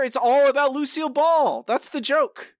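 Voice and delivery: indignant insistence; exasperated. Real, not acted. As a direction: argue it indignantly, pitch jumping up. Indignant argument over a missed joke.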